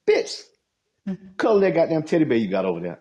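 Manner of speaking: high, sharp snap, then fast; retelling what he told her, mock furious; mock angry retort. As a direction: fire it back at her, hammed up. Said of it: "I was like".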